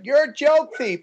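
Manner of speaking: imitated accusation, pitched well up; accusing heckler. Heckler accusing the comic on stage, mock high accusation. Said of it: "a girl yells".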